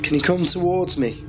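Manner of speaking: high, gentle calling out; coaxing. Real, not acted. Calling out to spirit.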